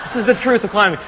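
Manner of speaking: normal talking voice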